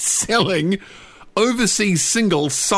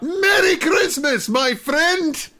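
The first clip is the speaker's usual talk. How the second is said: mock yell; 12 semitones higher, slower than usual. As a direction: yell it out like a whole crowd joining in. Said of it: "they just yell out".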